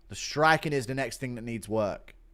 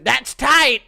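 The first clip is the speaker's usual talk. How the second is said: loud, high imitated yell; DC and Rogan; 10 semitones higher, 10 dB louder than usual. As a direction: yell it like two excited fight commentators. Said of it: "D C and Rogan yelling".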